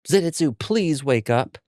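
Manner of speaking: high, drawn-out plea; pleading boy. A narrator's reading. Boy begging Zenitsu to wake, high and pleading.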